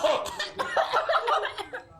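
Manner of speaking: big helpless laugh, high and loud; loud burst of laughter. Real, not acted. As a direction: crack up loudly at the pain. Reaction to super hot sauce.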